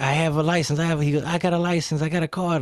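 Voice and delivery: high, imitated pleading; mock-defensive high protest; driver protesting. Acts the driver protesting to cop.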